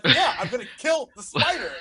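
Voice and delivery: high, loud, laughing through it; mock oblivious player. Player targeting the wrong creature, laughing loud mock confidence. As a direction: say it proudly while cracking up. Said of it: "And he's like".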